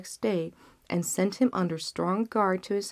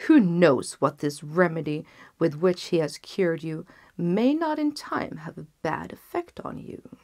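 scheming vizier; 4 dB louder than usual. Acted. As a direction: lean in and plant suspicion. Vizier warns the king.

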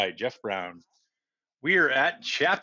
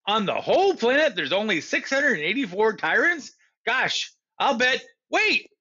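put-on excited boy; 8 semitones higher than usual. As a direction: build up then blurt out Wait. Stanley working it out, excited.

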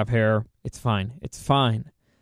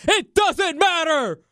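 high, shouted wrestler tirade; The Rock; 12 semitones higher than usual. Acted. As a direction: bellow like a wrestler cutting off an answer. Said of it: "The Rock would have been like".

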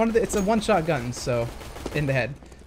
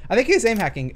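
voice crack; 5 semitones higher, 4 dB louder than usual. Real, not acted. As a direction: voice cracking, pushed louder. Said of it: "Oh, that was a voice crack".

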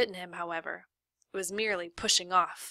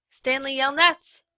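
calling out; 7 semitones higher, 8 dB louder, slower than usual. Acted. Lawyer calling out across lake.

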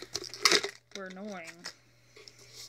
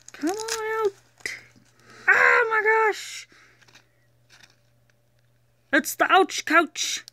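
excited squeal; 12 semitones higher, 5 dB louder than usual. Real, not acted. Coaxing toy out of bag.

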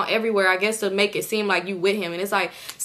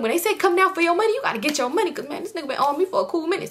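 worked-up mock explanation; 8 semitones higher, faster than usual. Acting out man justifying the detour.

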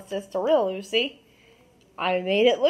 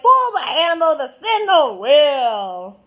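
louder, slow game-show line; toy announcer voice; 5 semitones higher, 8 dB louder, slower than usual. Retold or imitated. Kid imitating the basketball toy's game announcer. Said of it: "and it's like".

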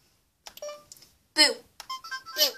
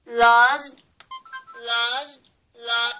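loud; 2 semitones lower, 8 dB louder, much slower than usual. Shown as a demonstration. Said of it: "trying to say it backwards".

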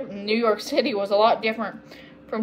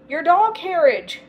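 higher, louder exclamation; dismayed cry; 7 semitones higher, 5 dB louder than usual. A narrator's reading. Agnes remembering the doll carriage, crying out. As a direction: cry out in alarm. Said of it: "cried Agnes".

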